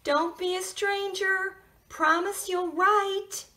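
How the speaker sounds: high, tender farewell; a hint of a sad neighbor; lightly voiced neighbor.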